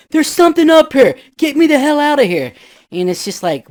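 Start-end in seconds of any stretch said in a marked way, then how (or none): 0.1-2.5 high, loud, panicked radio cry; frightened yell. Retold or imitated. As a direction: shout in terror to get out. Frightened guys yelling on radio.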